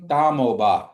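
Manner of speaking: "Damoba" clearly spoken for the class; Kiowa demo to repeat. Shown as a demonstration. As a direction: say "Damoba" for them to copy. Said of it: "Can everybody say that for me?".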